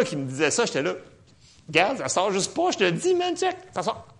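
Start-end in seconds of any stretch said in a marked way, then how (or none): 1.7-3.4 high, insistent, half-laughing plea; exasperated pleading. Retold or imitated. Pleading with the man praying over him, high and exasperated. 3.4-4.1 high, laughing attempt; hopeful try. Trying again before the man praying, high and laughing.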